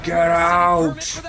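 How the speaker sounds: high, loud shout of get out; retold shout. Banner shouting at Jean to get out. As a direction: bark it out like a man losing control. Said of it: "but then he's like".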